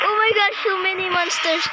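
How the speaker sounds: high, loud cry of alarm. Real, not acted. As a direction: panic as monsters close in. Suddenly faced with many monsters.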